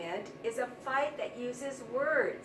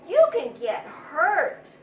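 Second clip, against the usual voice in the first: loud, slow warning in a higher mouse voice; worried squeak; 4 semitones higher, 10 dB louder, slower than usual. A narrator's reading. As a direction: plead with her to stay safe. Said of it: "squeaked her friend".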